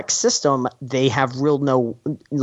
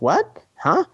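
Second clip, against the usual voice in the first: higher-pitched puzzled "what?", mock confusion; acted bafflement; 8 semitones higher than usual. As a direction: play a baffled viewer reacting. Acting out a puzzled viewer.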